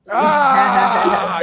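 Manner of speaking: loud high exclamatory ah; gleeful shout at the punchline; excited shout. Real, not acted. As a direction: throw out a big punchline ah.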